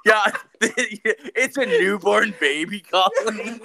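high, slow, breaking into laughter; laughing. Real, not acted. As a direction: laugh through every word. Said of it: "laughing hard at the joke".

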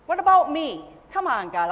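a mock-whiny plea with a loud pitch jump; whiny complaint. Believer complaining to God, mock whiny protest. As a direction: play the petulant child.

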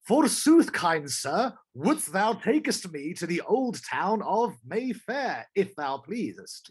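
high, loud and slow mock Shakespearean; hammy stage actor. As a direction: ham it up like an old stage actor. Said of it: "and going".

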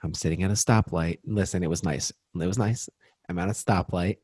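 low-key, confiding story voice; hushed storytelling voice. Acted. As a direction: set the scene low and measured. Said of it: "say this in this voice".

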